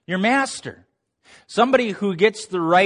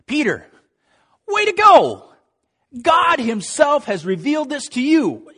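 higher, bright praise for Peter; higher, louder congratulation, way to go; excited praise; 10 semitones higher, 5 dB louder than usual. Retold or imitated. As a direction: cheer him on like a proud teacher. Jesus praising Peter, voiced.

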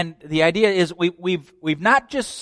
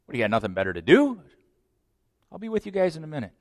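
quick banter; 3 dB quieter, much faster than usual. Acted. Quick back and forth dialogue.

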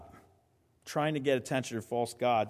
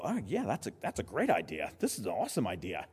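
low, softer crowd chatter; murmuring crowd; 7 semitones lower, 6 dB quieter, much faster than usual. Acted. The people agree to the test, murmuring. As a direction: play onlookers agreeing among themselves, low. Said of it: "murmuring of the crowd".

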